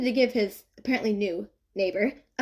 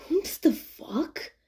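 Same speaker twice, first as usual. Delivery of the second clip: slow, drawn-out groggy grumble; just woke up and annoyed; sleepy disgruntled young man; groggy mutter; 6 semitones higher, much slower than usual. A narrator's reading. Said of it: "he mutters groggily".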